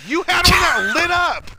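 high, slow mock yell at the screen; hammed-up outraged yell; retold yell at the TV. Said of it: "I literally yelled at my T V".

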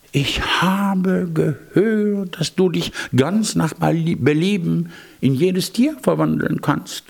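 a cat's high fawning voice; sly cat voice. Acted. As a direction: flatter him as the cunning cat. Cat speaks to the magician.

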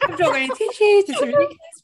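very high, flustered and embarrassed; real welling-up, voice shooting up high; emotional. Real, not acted. Guest scolds herself for getting upset, high and emotional. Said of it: "I'm just getting a bit emotional".

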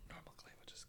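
hushed whisper. Acted. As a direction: keep it a breathy secret. Whispered aside continues.

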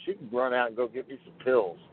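normal talking voice

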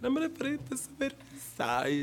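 fake Brazilian Portuguese. Shown as a demonstration. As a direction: stretch "La frase francesa" into fake Brazilian. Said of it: "También puedo hacer el brasileño".

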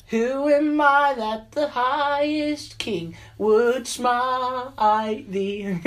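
slow, high, hammed-up King James voice; mock biblical prophet. Parodying the lyric biblically.

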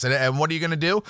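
usual speaking voice

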